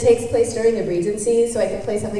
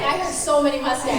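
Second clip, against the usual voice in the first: ordinary talk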